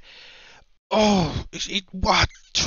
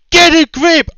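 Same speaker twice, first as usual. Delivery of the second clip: ordinary delivery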